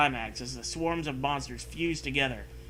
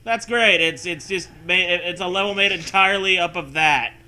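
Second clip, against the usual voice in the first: sarcastic; 4 semitones higher, 8 dB louder than usual. Real, not acted. Mocking the game's scare.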